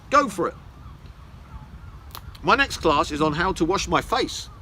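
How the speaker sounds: ordinary talk, nothing marked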